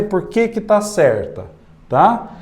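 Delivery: ordinary delivery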